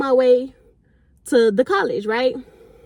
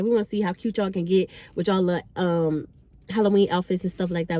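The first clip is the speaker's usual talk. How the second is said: normal talking voice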